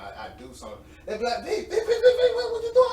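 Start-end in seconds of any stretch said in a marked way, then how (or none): 1.4-2.9 loud, very high rapid-fire calling; mock alarmed shout. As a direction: snap someone out of a daze. Coworkers alarmed, snapping him out.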